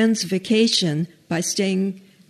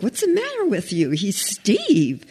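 upset protest; 2 semitones higher than usual. Retold or imitated. Mary corrects the pastor who keeps saying Arthur, upset. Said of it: "Mary was upset".

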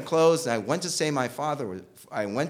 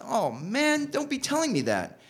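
high, pained disbelief; dismayed; 12 semitones higher than usual. Retold or imitated. Hearing his best friend died, high dismay. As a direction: plead against the bad news. Reacting to the death news.

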